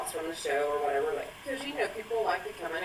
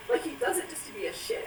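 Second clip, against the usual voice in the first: ordinary talk, nothing marked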